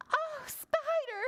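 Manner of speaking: high, drawn-out flustered cry; high storybook wail for help; flustered ladybug. A narrator's reading. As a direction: fret like a panicky little ladybug. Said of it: "she flapped".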